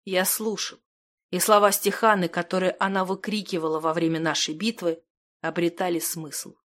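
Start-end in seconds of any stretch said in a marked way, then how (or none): none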